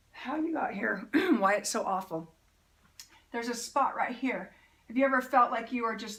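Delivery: usual speaking voice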